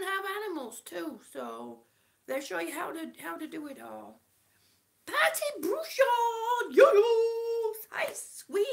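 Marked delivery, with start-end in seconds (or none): 5.1-8.7 high, loud, drawn-out sing-song greeting; excited greeting. Real, not acted. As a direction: call out the viewer's name with delight. Greeting live chat viewer.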